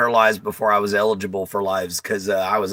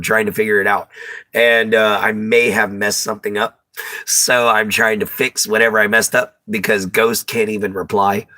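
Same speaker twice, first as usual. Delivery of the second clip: normal talking voice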